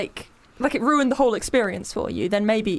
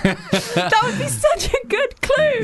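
high, drawn-out words through laughter; laughing; 11 semitones higher, much slower than usual. Real, not acted. Guesser who missed the show jokes about the clue, laughing. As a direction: laugh helplessly while saying it.

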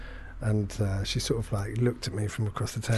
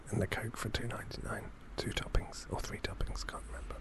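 fading whisper; 14 dB quieter than usual. Real, not acted. Sad drunk memory trailing off.

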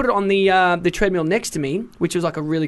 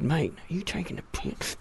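much softer, a quiet menace under it; calmly, with a threat laced underneath; 10 dB quieter than usual. Retold or imitated. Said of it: "Calmly, but with a, an air of threat".

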